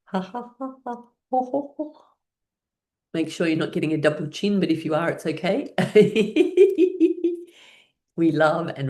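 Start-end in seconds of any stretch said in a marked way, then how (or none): 0.1-2.0 high ho-ho laugh. Shown as a demonstration. Laughter yoga chant. 5.8-7.4 loud high laugh. Real, not acted. Laughing at double chin joke.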